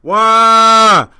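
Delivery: long, loud held-out cry; drawn-out holler. Real, not acted. Host opening the show, drawn-out holler. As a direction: stretch one word into a sustained holler.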